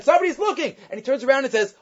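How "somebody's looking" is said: retold scream of alarm, pitched up; mock scream. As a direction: cry out the warning in a pitched-up mock yell. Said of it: "starts screaming again".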